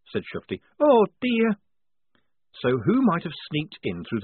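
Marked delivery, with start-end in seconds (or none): 0.8-1.6 a lightly voiced anxious girl; Shufti's voice. A narrator's reading. Shufti lamenting the flattened coffee engine, high.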